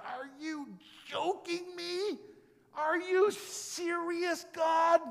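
high, slow, incredulous cry; mock outrage thrown up at God; disbelieving outrage. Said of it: "It's like".

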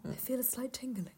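very soft, whispery observation; whispered film quote. Retold or imitated. Friend quoting tipsy Legolas in drinking scene, soft whisper. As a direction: whisper it, understated.

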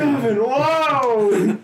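slow, laughing wow; laughing it out slowly. Real, not acted. Laughing at the name.

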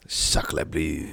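cartoon Frenchman aside; put-on French accent; mock French accent. Mocking French people after fake laugh.

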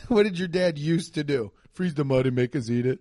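ordinary talk

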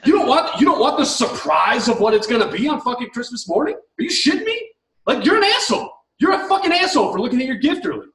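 indignant rant with high, punchy bursts; exasperated, incredulous scolding; fired up. Real, not acted. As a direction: lay into them, pitch leaping up in disbelief. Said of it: "I get fired up".

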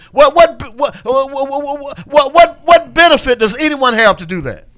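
high, loud, stammered challenge; exasperated sputtering. Real, not acted. Host challenging a claim about skewed data, exasperated. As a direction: trip over the first word in outrage. Worked-up challenge, stuttering repeats.

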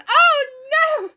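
high, loud dramatic wail; over-the-top mock despair. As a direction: fling it out like a melodrama heroine. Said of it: "and goes".

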